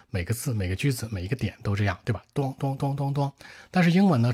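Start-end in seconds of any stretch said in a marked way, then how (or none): 2.3-3.3 mock heavy, blunt drumming beats; blunt thudding syllables. Shown as a demonstration. Teacher imitating blunt Chinese rhythm, heavy thuds. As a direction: thud out each beat separately. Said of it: "中文说话是很钝的".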